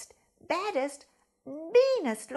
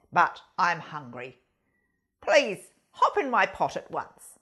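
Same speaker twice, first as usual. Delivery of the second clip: much lower, louder fox; hungry fox voice; 10 semitones lower, 9 dB louder than usual. Acted. Fox speaks to the goose, low and loud.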